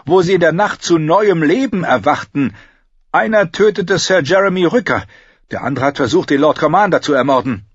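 stiff, resentful knight's report; gruff knight's report. Acted. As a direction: Sir Alicer reporting grimly to the court.

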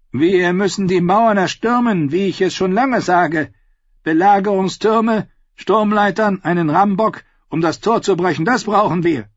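high, loud, forceful war talk; blustering knight. Acted. Sir Devon demanding the assault from the council, loud and forceful. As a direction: bang the table for siege towers.

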